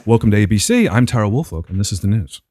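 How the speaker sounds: low, measured newsreader impression; news anchor voice. Host imitating the caller on the news, anchor voice. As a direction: sign on like a radio anchor. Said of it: "She has a radio voice".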